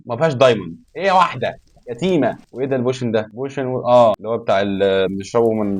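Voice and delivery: ordinary talk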